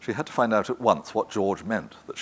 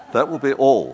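a louder, firm dismissal of the servants; 6 semitones higher, 9 dB louder than usual. Acted. Daphne dismissing the servants at the table, firm and louder. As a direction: close it off firmly, no discussion.

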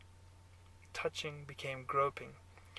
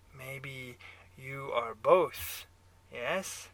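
put-on slow, louder German-accented needling; 6 dB louder, much slower than usual. A narrator's reading. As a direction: tease out an answer in a German accent. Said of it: "your German accent".

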